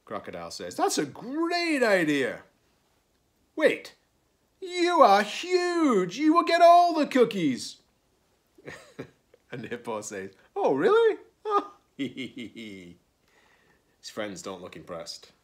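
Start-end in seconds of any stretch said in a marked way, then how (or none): 0.8-2.4 high, loud, drawn-out approval; enthusiastic. A narrator's reading. Crocodile welcomes Hippo's sharing idea, enthusiastically. 3.6-7.8 very high, loud, stretched protest; outraged realisation. A narrator's reading. Crocodile realises huge Hippo wins, outraged. 10.6-11.2 high, loud, innocent question; mock surprise. A narrator's reading. Hippo hears he gets all cookies, feigning surprise.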